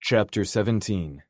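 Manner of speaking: plain reading voice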